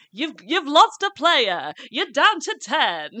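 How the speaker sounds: slow, high, drawn-out mock pep talk; director giving motivation. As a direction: imitate a director earnestly motivating an actor, stretching the words. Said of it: "like a director trying to give your actor motivation".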